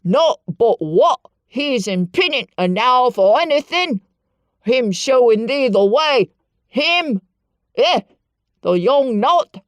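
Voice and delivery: loud Yorkshire scolding; outraged old man. A narrator's reading. Ben shouts down at Mary, outraged. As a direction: scold her from above. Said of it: "he called down at her".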